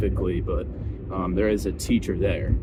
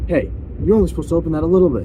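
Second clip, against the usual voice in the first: higher, quick mock reprimand; pitched-up, hurried telling-off; imitated teacher scolding; 7 semitones higher, 4 dB louder, much faster than usual.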